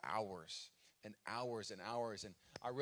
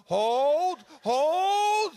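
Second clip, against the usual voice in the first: mock shout; 15 dB louder than usual. Joking about his hairline holding.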